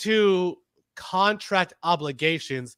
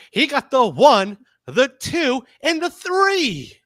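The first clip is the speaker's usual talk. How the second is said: rising louder count, slowing down; excited pin count; 6 semitones higher, 6 dB louder, slower than usual. Retold or imitated. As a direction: call the three count like a ref. Counting out the pinfall.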